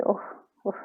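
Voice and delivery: ordinary talk, nothing marked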